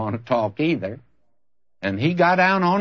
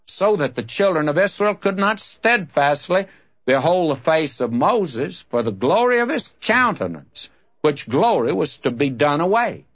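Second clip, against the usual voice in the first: raised, emphatic Bible reading; preacher's swelling, higher-pitched verse reading; Scripture reading; 4 semitones higher than usual. Real, not acted. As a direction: proclaim the verse to a congregation. Reads Scripture aloud to congregation.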